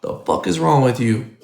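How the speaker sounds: usual speaking voice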